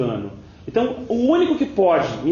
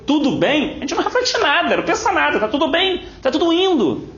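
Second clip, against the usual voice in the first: higher, breezy mock nonchalance; airy higher voice mocking complacency; mock carefree; 8 semitones higher than usual. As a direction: play the careless believer who sees nothing. Mocking careless complacent attitude.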